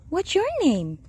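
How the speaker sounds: high sing-song question; high-pitched friendly cooing; pet talk. Real, not acted. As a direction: coo a greeting at a cat. Talking to the cat.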